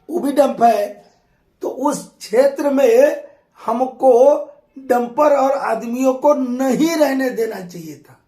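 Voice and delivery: ordinary talk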